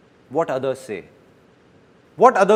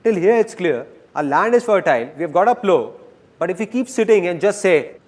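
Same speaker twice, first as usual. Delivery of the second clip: ordinary talk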